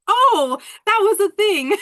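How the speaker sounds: high, loud amused surprise; surprised realization. Retold or imitated. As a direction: squeal it in delighted discovery. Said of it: "I'm just like".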